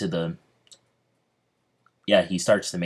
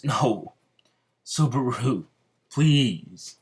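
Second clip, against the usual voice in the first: higher-pitched, pleading cry for help; pleading, with pauses between the words; desperate plea; 4 semitones higher, much slower than usual. Acted. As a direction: beg a friend to come, mildly acted. Calling for Subaru as he collapses.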